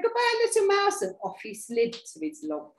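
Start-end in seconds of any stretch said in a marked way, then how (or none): 0.0-1.0 high, loud, quick goodbye; frightened farewell. Acted. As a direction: blurt a panicky goodbye and flee. Snake flees in fright.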